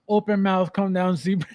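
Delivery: ordinary delivery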